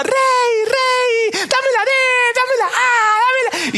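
high, pleading crowd cries; mock crowd cry. Party crowd begging the king, high and excited. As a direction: beg the king like an eager crowd.

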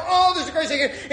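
high-pitched gushing, acted out; hysterical joy. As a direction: gush like someone who can't contain it. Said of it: "he's hysterical".

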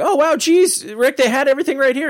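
put-on high-pitched, excitable kid sidekick; Morty. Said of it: "Morty leaves the room, comes back, he's like".

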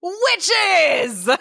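high, drawn-out delighted cry; thrilled exclamation. Real, not acted. Co-host delights in the witches' spell, thrilled. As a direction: hail the witches with glee.

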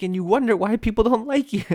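high, loud mock outrage; exasperated scolding. Comedian chiding another comic, loud mock exasperation.

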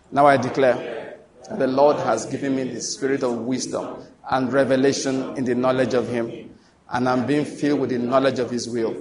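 measured, level congregational recitation; recited declaration. Acted. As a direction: lead the group steadily, phrase by phrase. Leads congregation in spoken declaration.

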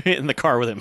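ordinary talk

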